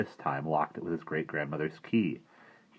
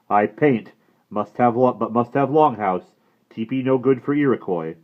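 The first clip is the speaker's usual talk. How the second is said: put-on loud, stern brave scolding the boy; a proud warrior unimpressed by fuss; disapproving Indian brave; 6 semitones higher, 10 dB louder than usual.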